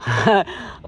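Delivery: short burst of real laughter. Real, not acted. Host amused by a contestant's animal noise, laughing.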